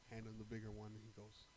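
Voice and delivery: ordinary talk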